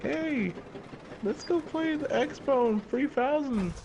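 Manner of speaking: put-on high, drawn-out kid voice; excited kids. Kids inviting each other to play, mimicked in a high voice. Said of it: "those kids are like".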